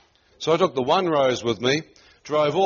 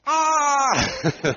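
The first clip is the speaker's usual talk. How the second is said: very high yelp of delight; high thrilled squeal; excited shriek; 13 semitones higher than usual. Retold or imitated. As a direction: squeal like the news just landed. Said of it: "I'm going".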